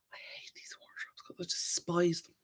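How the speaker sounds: soft whisper, as if sharing a secret; whispered grumble about the wardrobes; whispered confession. Real, not acted. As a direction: breathe it like a guilty secret. Confiding dislike of the wardrobes.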